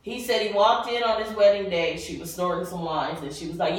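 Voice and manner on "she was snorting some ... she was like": normal talking voice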